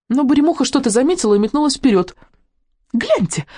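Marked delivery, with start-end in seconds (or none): 2.9-3.5 high little dragon squeak. A narrator's reading. Dragon alerts her friends to a find, squeaking. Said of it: "пискнула она".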